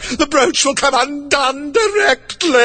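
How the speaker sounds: a touch of a groan, high; mildly groaned, high. Acted. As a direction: moan over the shawl. Queen voiced in a story.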